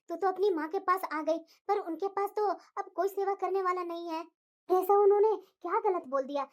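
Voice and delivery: low, calm mother voice; mother calming her angry daughter, low and gentle; soothing mother. Acted.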